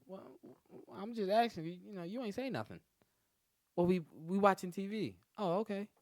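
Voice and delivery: mock girl voice. The girlfriend, caught out, answering in a high stammer.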